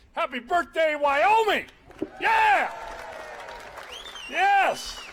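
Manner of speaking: rally the crowd with a whoop; cheering shout. Real, not acted.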